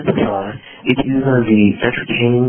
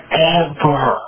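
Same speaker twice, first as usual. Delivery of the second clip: "Ever." set apart and pitched up; emphatic EVER; 4 semitones higher than usual. Real, not acted. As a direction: land a final, higher "Ever." after a pause. Last of repeated evers, for emphasis.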